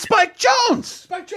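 high, slow squeal of delight; squealing fangirl. Pretend. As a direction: gush like a starstruck fan. Mock gushing over Spike Jonze.

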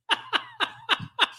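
very high burst of genuine laughter; high, helpless real laugh. Real, not acted. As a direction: crack up in disbelief. Laughs at bad record.

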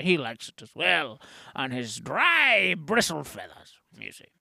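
put-on voice with swooping pitch; hammed-up character lecture, relishing odd details; quirky sidekick explainer. Sidekick explains Mister Beak to host, swooping character voice.

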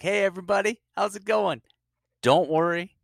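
high, loud greeting to the crowd; stand-up opener. Pretend. Comic opening his little bit, mock big stage greeting. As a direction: hit them with a big comic's hello.

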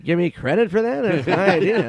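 high, loud mock grievance; Norm Macdonald. Comedian demanding credit for breakfast idea, mock-indignant impression. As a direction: imitate a comic stammering in petty protest. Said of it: "He goes".

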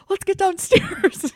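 high, slow, loud eager call; excited Christmas morning. Retold or imitated. Adult on Christmas morning rousing family, high excited call. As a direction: call the kids down, bursting with excitement. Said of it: "And I'm like".

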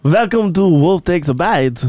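put-on slow, hammy French-accented welcome, barely French; bad French accent. Host reopens the show in a shaky fake French accent, slow. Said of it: "Little, little bit not French".

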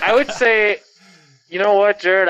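muffled behind a mask; talking through a cloth mask; through a face mask. Real, not acted. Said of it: "I got a mask over my face".

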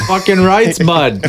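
high-pitched mock Canadian; Canadian accent. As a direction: cheer it in a put-on Canadian accent.

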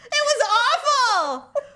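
slow, drawn-out "awful", pitched high; emphatic laughing dismay. Real, not acted. Woman repeating her humiliation for emphasis, slow laughing wail.

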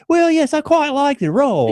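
high-pitched, put-on English actor; mock English accent. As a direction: play a refined English interviewee. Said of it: "then you see him in an interview".

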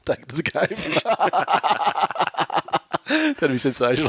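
laughing through the words. Real, not acted. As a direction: giggle out the words. Laughing at his own joke.

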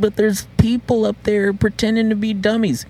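put-on high-pitched childish protest; imitated scared kid. Kid protesting to dad about haunted porch, high-pitched. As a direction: plead like a reluctant little kid.

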